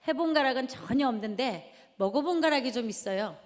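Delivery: ordinary talk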